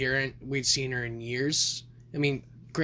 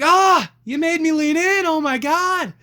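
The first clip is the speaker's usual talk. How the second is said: high, loud mock outrage; squealing grossed-out protest; disgusted shriek; 13 semitones higher, 9 dB louder than usual. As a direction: shriek it like you were tricked. Disgusted after leaning in.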